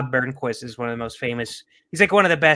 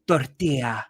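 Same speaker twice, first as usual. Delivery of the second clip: "tortilla" in a put-on Spanish accent; tapped Spanish R in "tortilla"; slower than usual. Shown as a demonstration. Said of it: "if you say them in the accent".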